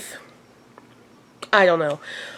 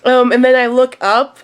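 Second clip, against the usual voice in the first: usual speaking voice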